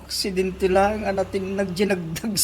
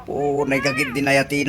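ordinary delivery

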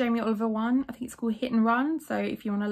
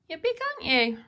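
cat voice; 6 semitones higher than usual. Real, not acted. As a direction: coo over the cat. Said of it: "talks to their cat in a cat voice".

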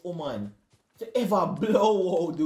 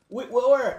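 normal talking voice

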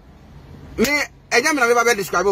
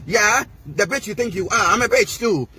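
ordinary delivery

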